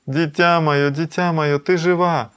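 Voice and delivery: high, loud cries of a mother's relief; gushing, breathless excitement. A narrator's reading. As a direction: tumble the words out in acted joy. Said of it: "захлёбываясь словами на ходу".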